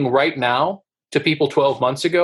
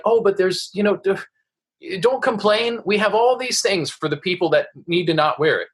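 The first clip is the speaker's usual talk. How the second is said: higher, sing-song mock of smug officials; a sarcastic, falsely soothing authority voice; 6 semitones higher than usual. Officials brushing off complaints about the mandate, mocked in a higher sing-song. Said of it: "they'll be like".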